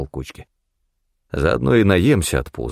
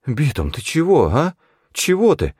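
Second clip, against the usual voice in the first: higher anxious crony voice; hurried babbling; 8 semitones higher than usual. A narrator's reading. Федька backing off from the hunter, nervous babble. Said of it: "торопливо залопотал Федька".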